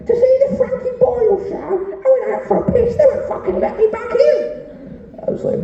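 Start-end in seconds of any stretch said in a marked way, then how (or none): 0.1-4.4 high-pitched fan. Retold or imitated. Comic retells a wet fan accosting him, in a squeaky high voice.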